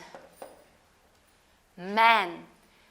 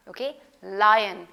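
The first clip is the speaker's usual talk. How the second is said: ordinary talk, nothing marked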